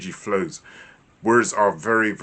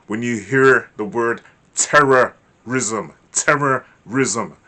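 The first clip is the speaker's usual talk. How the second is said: slow, louder, drawn out; ominous emphasis; 5 dB louder, slower than usual. Shown as a demonstration. Teacher intones a scary news word. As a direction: weigh terrorism heavily. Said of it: "what does that conjure up in your mind".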